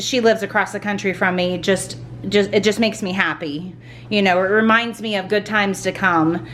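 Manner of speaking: ordinary talk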